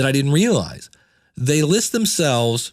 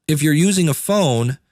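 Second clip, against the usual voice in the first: normal talking voice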